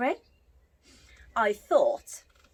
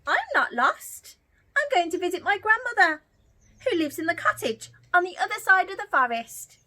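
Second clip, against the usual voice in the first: put-on high, bright-eyed little girl voice; 9 semitones higher, 4 dB louder than usual. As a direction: speak up as a chirpy little girl.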